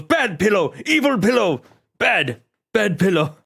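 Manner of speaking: high, loud hammed-up scolding of a pillow, slowing at the end; gruff mock scolding. Red Guardian scolding the pillow he tore, mock scolding. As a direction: scold the pillow like a naughty pet.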